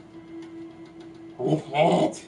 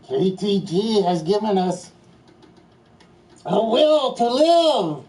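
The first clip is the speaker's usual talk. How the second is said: menacing evil villain; 6 semitones higher, 3 dB louder than usual. Acted. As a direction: swell into a dramatic threat.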